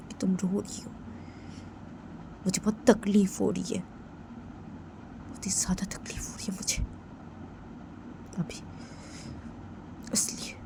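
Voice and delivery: whispered hurt; hushed aching confession; pained whisper. Pretend. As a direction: breathe out your hurt in a whisper. Character whispers her pain.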